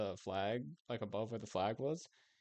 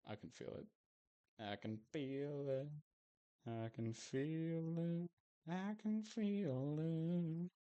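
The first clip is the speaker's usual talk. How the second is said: from a murmur to a higher, drawn-out, slowing chant; dramatic build; 5 semitones higher, slower than usual. Pretend. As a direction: savor each repeat, rising like a prophecy. Anticipating a huge room.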